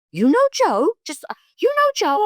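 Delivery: high-pitched incredulous question; imitating two astonished men. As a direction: squeal it in amazed disbelief. Retold men astonished at the connection.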